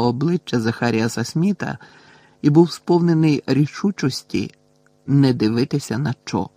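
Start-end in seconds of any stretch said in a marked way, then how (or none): none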